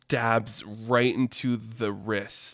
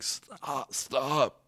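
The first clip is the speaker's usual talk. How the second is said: much softer, fading plea; feeble dying stop; 6 dB quieter than usual. Retold or imitated. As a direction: gasp it out with the last strength. Dying Sam reaches for Scrooge.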